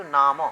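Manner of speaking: "নাম" with a short o sound after the ম, punched loud; half-vowel ending. Shown as a demonstration. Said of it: "উচ্চারণটা হচ্ছে অর্ধস্বর".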